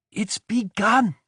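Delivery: high, slow and glad; happily. Acted. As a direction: lift it up with joy. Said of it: "she said happily".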